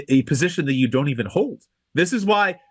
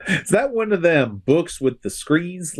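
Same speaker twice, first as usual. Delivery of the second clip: put-on slow, higher-pitched Southern accent; 6 semitones higher, slower than usual. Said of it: "I don't know why I did a Southern accent there".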